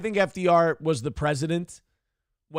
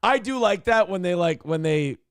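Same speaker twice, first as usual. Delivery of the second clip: ordinary talk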